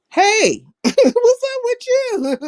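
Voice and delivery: high, laughing hello drawn out slowly; excited greeting. Real, not acted. Greeting a viewer in chat, high and laughing. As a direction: greet them with a big laughing hey.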